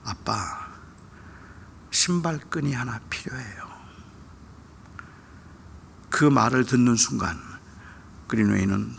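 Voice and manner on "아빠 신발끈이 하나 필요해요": put-on soft, hesitant child's request; careful, hushed little request; very cautious. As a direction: ask timidly, pausing after the first word. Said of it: "아주 조심스럽게 입을 열어서 말합니다".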